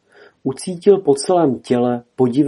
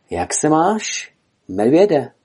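slow, higher, tentative question; slightly nervous; 5 semitones higher, slower than usual. A narrator's reading. Elk Kulich greets the bear, slightly nervous. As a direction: greet warily, rising and drawn out. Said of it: "zeptal se trochu nervózně Kulich".

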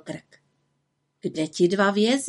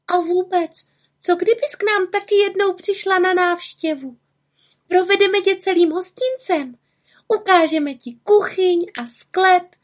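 put-on much higher, eager girl's voice; girl Frona inviting; 11 semitones higher, 3 dB louder than usual. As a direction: invite the witch home with excitement. Narrator voicing Frona.

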